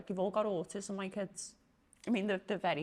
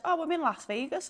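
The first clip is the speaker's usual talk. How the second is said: high, louder mock amazement; retold wonder; 10 semitones higher, 6 dB louder than usual. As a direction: imitate someone marvelling mid-trip. Retells friend hallucinating on DMT.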